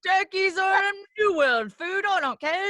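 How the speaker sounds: a mock olde English vendor at a Renaissance fair; Ren fair accent. Host playing a Ren fair turkey-leg seller, in a hammed-up olde English accent. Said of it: "that's my Ren fair accent".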